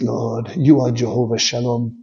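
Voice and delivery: ordinary delivery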